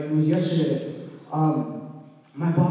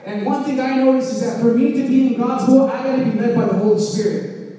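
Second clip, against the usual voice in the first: raised, ringing preacher voice; preaching at full force; 4 semitones higher, 5 dB louder than usual. Acted. Pastor urging the congregation, full preaching voice. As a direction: preach it out to the whole room.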